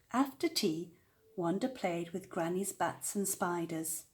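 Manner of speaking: ordinary delivery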